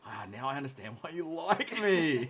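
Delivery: giggling through a self-roast; real laughter; laughing. Real, not acted. Joking back, both laughing.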